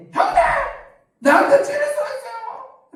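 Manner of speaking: very high, squealing mock protest; hammed-up indignant cry at an older brother; hot-tempered outburst. Pastor playing a fleshly David at his brother, hammed-up outburst. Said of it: "다윗이 육적으로 막 성질 충만했으면".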